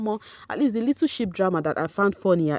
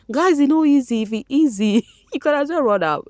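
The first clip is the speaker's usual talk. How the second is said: much higher and louder, laughing; excited laughing; 9 semitones higher, 6 dB louder than usual. Real, not acted. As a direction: laugh through the complaint. Laughing complaint to viewers.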